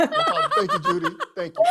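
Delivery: high laughing thanks; laughing while thanking. Real, not acted. Host thanking Judy on Zoom, laughing.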